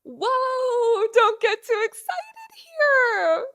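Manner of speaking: high, loud, slowed-down alarmed whoa; alarmed protest. Retold or imitated. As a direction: rein in an overexcited stylist. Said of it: "I'm like".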